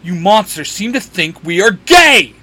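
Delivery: loud, high-pitched indignant outburst; exasperated snap. A narrator's reading. Dean snaps at the monsters about his brother, exasperated. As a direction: snap back, offended and loud. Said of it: "Dean snaps".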